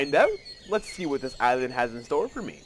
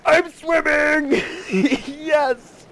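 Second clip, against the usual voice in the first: high, loud, drawn-out squeal; elated high-pitched cheer; overjoyed; 12 semitones higher, 6 dB louder, much slower than usual. Real, not acted. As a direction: squeal with delight, breaking into laughter. Thrilled to find swimming works.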